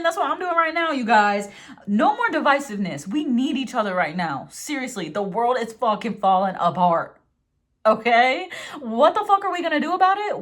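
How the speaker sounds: rally everyone with urgent emphasis; preaching. Real, not acted. Tarot reader preaching unity to viewers, fired-up. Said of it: "preaching today".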